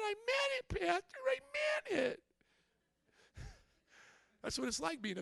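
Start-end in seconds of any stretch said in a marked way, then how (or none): 0.0-2.2 much higher, pleading whine; mock pleading "I meant it"; mock pleading congregant. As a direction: ham up a desperate churchgoer insisting. Pastor mimics pleading congregant.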